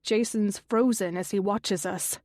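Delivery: ordinary delivery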